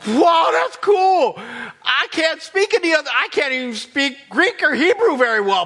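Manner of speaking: high, loud acted marveling; amazed excitement. Disciples finding they speak new languages, amazed. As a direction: gush in delighted disbelief. They're thinking.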